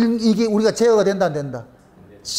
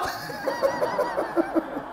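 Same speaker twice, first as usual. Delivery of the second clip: high burst of laughter; 9 dB quieter than usual. Real, not acted. Preacher laughs at his own joke, high burst of laughter.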